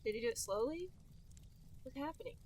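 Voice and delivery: ordinary talk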